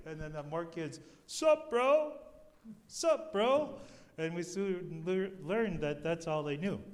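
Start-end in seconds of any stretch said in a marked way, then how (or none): 1.3-3.7 high, loud "Sup, bro?" called out; kids' greeting. Retold or imitated. Kids running up to the visitors, calling out loud and high. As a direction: call it out like eager kids.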